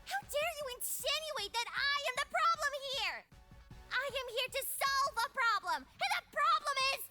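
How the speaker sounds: high-pitched indignant tirade; offended outburst. A narrator's reading. Love refusing to be called the problem, deeply offended. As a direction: protest in offended, rising outrage. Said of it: "Love was so offended".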